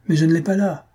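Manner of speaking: high, exasperated protest; cried protest. A narrator's reading. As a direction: cry out in self-defence. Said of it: "cria le don Juan de Laverse". (protestation criée, voix aiguë)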